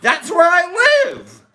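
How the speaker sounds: high, dopey dog voice; dog's inner voice. Retold or imitated. Dog wandering to the neighbors' smoke, high and dopey. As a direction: play the happy stoned dog.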